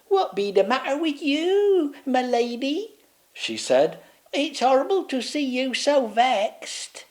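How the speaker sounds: put-on high, old-fashioned maid's voice; fussing maid. Maid fussing over the upset Queen, high. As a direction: dote on her lady.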